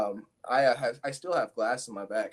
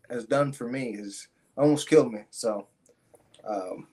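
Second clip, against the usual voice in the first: ordinary delivery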